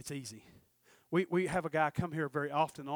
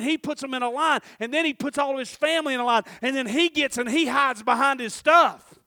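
rising to loud, high preaching; building preaching; 8 semitones higher, 14 dB louder than usual. Acted. Preacher building up Jacob's fearful return, loud and high. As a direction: build the story toward a climax.

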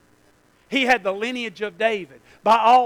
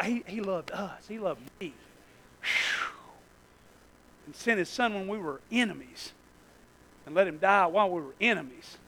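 much softer and lower, almost breathed; hushed awe; 3 semitones lower, 8 dB quieter than usual. Real, not acted. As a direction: let the wonder sink in quietly.

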